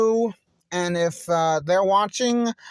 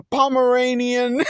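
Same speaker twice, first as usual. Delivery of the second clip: higher, breaking up into laughter; can't resist laughing. Real, not acted. Reader reaching a silly line, bursting into real laughter. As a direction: crack up on the word. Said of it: "I couldn't resist laughing".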